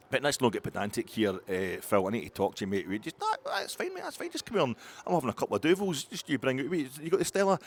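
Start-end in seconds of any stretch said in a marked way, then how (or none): 3.2-7.6 high hammed-up Belgian accent. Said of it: "That's a bit stereotypical".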